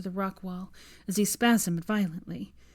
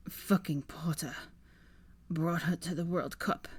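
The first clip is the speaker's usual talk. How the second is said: bitter whisper; 2 semitones lower, 5 dB quieter than usual. Acted. Dying Draco mutters bitterly.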